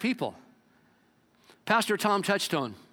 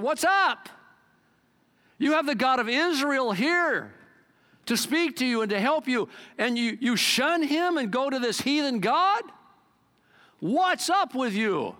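high, raised indignant question; indignant challenge; 5 semitones higher than usual. Retold or imitated. Elijah challenging the king's messengers, indignant and raised. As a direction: confront them, slowing the words.

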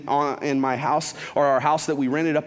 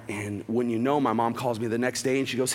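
usual speaking voice

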